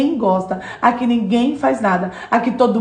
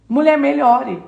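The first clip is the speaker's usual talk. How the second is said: ordinary delivery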